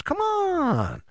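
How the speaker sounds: very high, drawn-out exclamation; soaring, exasperated appeal; incredulous come-on. Real, not acted. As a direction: stretch it out high in disbelief. Exclaims at the obvious answer.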